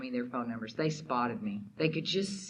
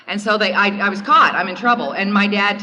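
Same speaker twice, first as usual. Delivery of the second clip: raised voice, almost shouting; loud exclaiming; 4 semitones higher, 12 dB louder than usual. Real, not acted. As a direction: blurt it out loudly.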